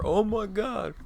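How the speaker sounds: high, loud mock scream; sudden high-pitched yell, imitating a friend; imitated scream. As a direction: shriek it like a startled friend in your ear. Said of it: "my friend screamed in my ear".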